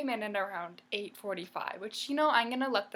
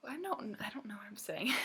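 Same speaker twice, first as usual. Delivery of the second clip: whispered, losing the thread; sheepish whisper to herself; flustered whisper; 6 dB quieter than usual. Real, not acted. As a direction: mutter it under your breath, embarrassed. Flustered aside mid-story.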